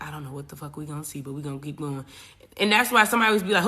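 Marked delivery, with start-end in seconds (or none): 0.0-2.0 softer, fast mumbled pep talk; muttered self-talk. Retold or imitated. Voicing people in a cave talking to the light, soft fast mutter. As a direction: mutter courage to yourself.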